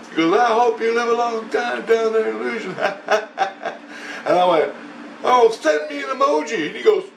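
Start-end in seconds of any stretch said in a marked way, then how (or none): 0.4-2.7 high imitation breaking into laughter; mock taunt. Storyteller mimicking the voice's curse, mocking. Repeats opponent's taunt, amused. 2.8-4.2 breathy chuckling burst; real laugh. Real, not acted. Storyteller amused by the insult, laughing. 5.2-6.7 very high mocking retort; sarcastic comeback. Man brushing off the curse, mocking.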